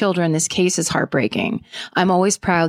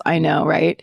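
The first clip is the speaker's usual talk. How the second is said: usual speaking voice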